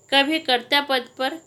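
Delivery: ordinary delivery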